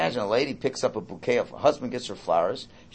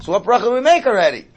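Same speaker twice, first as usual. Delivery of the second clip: impatient; 8 semitones higher, 10 dB louder, faster than usual. Retold or imitated. Mocks impatient questioner.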